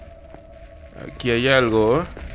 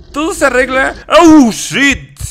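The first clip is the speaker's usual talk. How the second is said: high, loud exclaiming; loud, worked-up gamer reaction; startled outburst; 10 semitones higher, 9 dB louder than usual. Real, not acted. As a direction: shout out, rattled by the monster. Startled while boasting in game.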